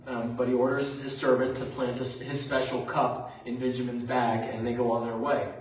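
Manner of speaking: ordinary talk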